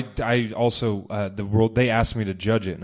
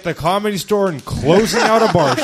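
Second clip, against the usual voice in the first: mocking imitation; 7 semitones higher, 4 dB louder than usual. Mocking the guest's plug.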